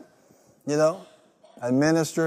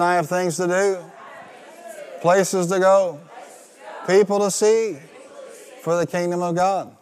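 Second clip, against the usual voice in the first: building to a loud, higher shout; louder declaration; 3 semitones higher, 3 dB louder than usual. Shown as a demonstration. Congregation repeats it, building to a shout. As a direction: declare it, rising with each phrase. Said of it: "Come on, say it again".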